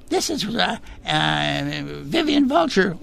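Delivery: high falsetto puppet voice; first choice of a squeaky puppet voice; Vivian Vulture. Shown as a demonstration. Puppeteer offers first voice choice, high falsetto. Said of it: "three choices on the voice here".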